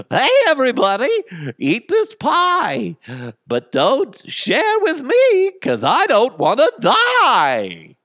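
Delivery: sing-song clown offering poisoned pie; high, loud, slow creepy clown pitch. Acted. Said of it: "That's my creepy clown voice".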